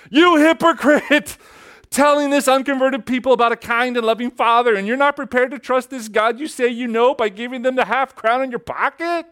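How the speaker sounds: a voice in his head screaming hypocrite at him; screaming inner voice. Retold or imitated. Inner voice screaming at Hudson over his half crown. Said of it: "there was this screaming voice in his head".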